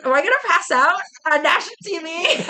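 high-pitched retold freak-out with a laugh behind it; mock panic. Founder recalling nerves before going on television, mock panic. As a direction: squeal the worry in comic panic.

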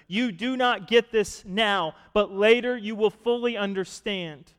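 Jesus firmly telling Peter he will understand later; firm teacher. Retold or imitated. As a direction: set him straight with authority. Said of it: "Jesus replied".

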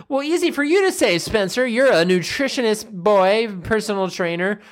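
high, quick whiny protest; lightly mocking client whine; mocking objection. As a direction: brush him off in a sulky high voice. Mock client objection to Spencer.

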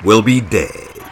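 plain reading voice

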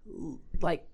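normal talking voice

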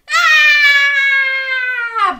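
high, loud ah as they tumble; falling cry. Acted. Everyone tumbling to the ground, falling cry. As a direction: yelp as you fall.

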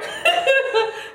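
high burst of laughter. Real, not acted. Laughing about her pain.

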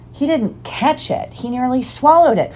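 mildly hammed-up howl of objection; howling protest. A narrator's reading. Flint contesting the result of the match, high howled protest. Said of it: "was still howling".

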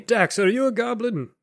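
high, put-on character voice; high-pitched incredulous question; puzzled merchant. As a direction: ask in a high, nosy vendor voice. Game master voices Digsby.

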